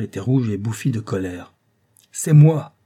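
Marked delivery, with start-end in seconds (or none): 2.1-2.7 high, very loud cry; furious dandy bursting in shouting; exclaimed. A narrator's reading. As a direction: burst in announcing himself. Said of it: "s'écria-t-il dès le seuil".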